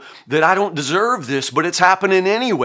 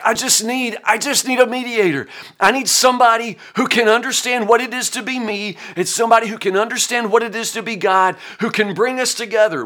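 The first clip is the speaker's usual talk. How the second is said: pleading Job; 7 semitones higher than usual. Retold or imitated. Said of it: "Job's like".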